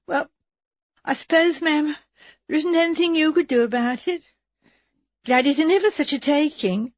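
a maid hoping her mistress can help; wistful maid. Acted.

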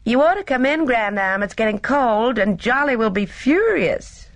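high, bright call from the terrace. A narrator's reading. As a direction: call her in from across the terrace. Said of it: "Gina called".

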